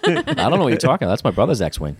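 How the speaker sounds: put-on high, fast laughing Boston accent; joking in a Boston accent. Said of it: "hit a Boston accent".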